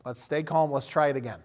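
quiet, steady parent coaxing; calm parent. Retold or imitated. Father calming his frustrated three-year-old, quiet and steady. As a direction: soothe the frustrated kid down gently. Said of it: "And I'm like".

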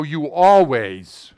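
loud, drawn-out mock accusation; exaggerated blaming outburst; angry accusation. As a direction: hammer it out, stretching ALWAYS.

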